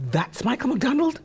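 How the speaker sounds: 2 rising, slow amazed question; 3 can't believe that's him; 1 surprised disbelief. Retold or imitated. 4 recalling watching the documentary, astonished question.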